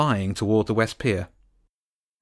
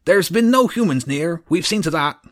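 higher, louder eager servant; Groat the guard; 6 semitones higher, 5 dB louder than usual. A narrator's reading. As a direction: reassure the boss nervously. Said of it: "Groat was the voice on patrol".

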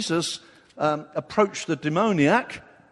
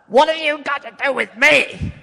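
much higher and louder, a possessed man's cry; demoniac crying out; 10 semitones higher, 9 dB louder than usual. Acted. The demoniac crying out at Jesus, loud and high. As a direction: hurl it at Jesus like a tormented demoniac. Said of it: "That's what the demoniac cried out".

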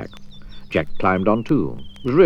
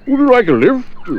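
high, loud friendly elephant; 6 dB louder than usual. Acted. Elephant offering Mister Daydream a lift, high voice. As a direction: offer the ride, high and bright.

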